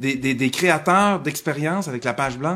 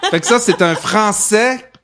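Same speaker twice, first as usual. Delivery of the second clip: laughing; 7 semitones higher, 7 dB louder than usual. Real, not acted. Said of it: "mon accent".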